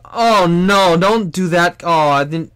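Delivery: high, loud fake alarm; mock scandalized follower. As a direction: gasp like a shocked fan. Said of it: "they were like".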